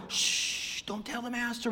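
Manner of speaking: long shush, then hushed words; urgent shushing. Acted. Crowd hiding problems from the master, hushed shushing.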